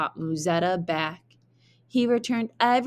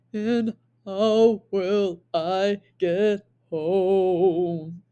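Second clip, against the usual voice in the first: whimpering giant; much slower than usual. Acted. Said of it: "whimpered the giant".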